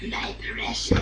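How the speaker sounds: hissing whispered creature voice; Gollum voice. Pretend. Joking on a podcast, doing Gollum in a whisper. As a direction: rasp it like a greedy cave creature. Gollum compared in cohost's joke.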